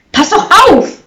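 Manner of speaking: loud, high warning shout; alarmed shout with pitch shooting up; uncontrolled outburst. A narrator's reading. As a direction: yell it, losing self-control. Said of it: "Er konnte sich nicht beherrschen".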